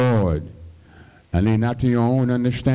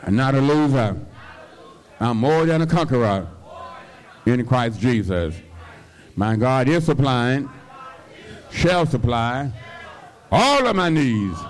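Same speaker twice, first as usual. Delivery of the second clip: building to a shouted climax on all of my needs; preaching at full force; 4 semitones higher than usual. Shown as a demonstration. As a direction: lead the congregation in a shouted confession. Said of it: "Somebody say".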